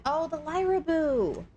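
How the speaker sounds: ordinary talk, nothing marked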